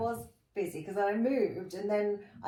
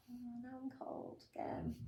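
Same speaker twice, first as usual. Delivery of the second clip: whispered mock whimper, much softer; 4 semitones higher, 15 dB quieter, slower than usual. Actress mocking herself shivering under studio aircon, whispered whimper. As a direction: whimper it in a tiny whisper, pretending misery.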